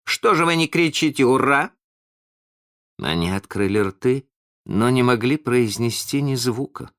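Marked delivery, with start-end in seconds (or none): 0.1-1.7 high, indignant question; mildly cross, raised pitch; vexed. A narrator's reading. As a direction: demand a cheer in a huff. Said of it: "сказал он в сердцах".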